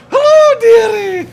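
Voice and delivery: put-on very high shrill old-lady falsetto; Mrs Doubtfire voice. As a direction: squeal it like a sweet old nanny. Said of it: "Missus Doubtfire as the A I robot".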